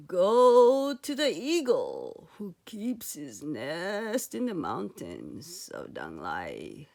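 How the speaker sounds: low, slow, mysterious wise-woman voice; old magic woman Apo Bia. Acted. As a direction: warn him slowly in a deep old crone tone.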